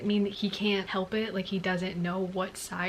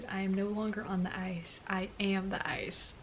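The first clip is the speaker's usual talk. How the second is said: hushed dramatic read; 6 dB quieter than usual. A narrator's reading. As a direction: let the words fall quietly, like a secret. Reading line in hushed voice.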